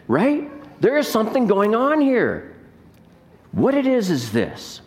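ordinary talk

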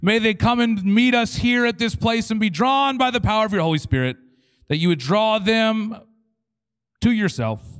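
hammed-up prayer, stretched words at full volume; fervent Pentecostal prayer. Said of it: "We pray these prayers, we say".

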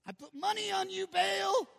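high, drawn-out exasperated yell; King Ahab. Acted. King who backed Baal and lost, frustrated yell. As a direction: wail it loudly in hammed-up frustration. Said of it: "think how frustrated King Ahab was".

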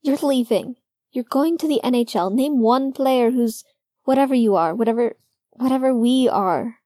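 upset pleading. A narrator's reading. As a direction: argue through the hurt. Logan arguing, upset.

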